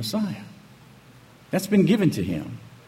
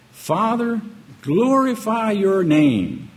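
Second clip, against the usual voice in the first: slow, drawn-out call to the Father, louder; pleading prayer; 7 semitones higher, 4 dB louder, much slower than usual. Acted. Yeshua calling out to the Father, slow pleading prayer. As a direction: lift the words up like a cry to heaven. Yeshua praying, read with feeling.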